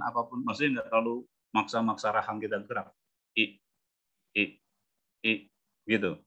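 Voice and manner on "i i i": I as a quick lax ih; short relaxed vowel. Shown as a demonstration. Coach showing the effortless short sound, relaxed. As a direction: clip each I short, jaw relaxed. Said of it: "kalau ngomong I pendek, itu santuy aja".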